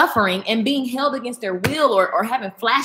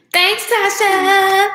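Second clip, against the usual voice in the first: high, slow sing-song thanks; drawn-out grateful thanks; stretched, lilting thank you; sweet thanks; 11 semitones higher, 5 dB louder, much slower than usual. Real, not acted. Thanking a viewer.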